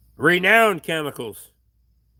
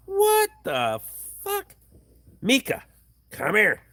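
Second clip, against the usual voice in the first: Luke on the phone; 5 semitones higher than usual. Retold or imitated. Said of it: "it's like".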